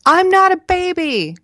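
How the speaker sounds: put-on very high, small child protesting; small strained voice. A narrator's reading. Child in back bedroom objects to babysitting, small strained voice. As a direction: strain it out like a little kid in the back room. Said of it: "A small voice strained from the back".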